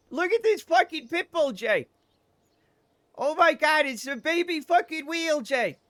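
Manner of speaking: hammed-up distress, pleading with a buddy; panicked guy impression. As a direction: imitate a frantic, high-voiced sidekick. Same frantic character calling Jay.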